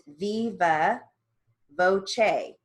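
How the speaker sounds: "viva" as VEE-va, "voce" as VOH-chay; demonstrated pronunciation. Shown as a demonstration. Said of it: "it also can be pronounced".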